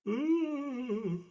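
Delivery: high ghostly oooh. Pretend. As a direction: moan it like a spooky ghost. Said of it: "Is that your ghost impression?".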